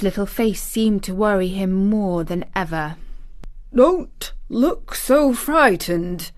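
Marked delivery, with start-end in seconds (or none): 3.7-6.3 slow, higher-pitched exclamation; exclaimed. A narrator's reading. Guardian startled by her anxious face, exclaiming slowly. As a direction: exclaim it, drawing out each word. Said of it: "he exclaimed".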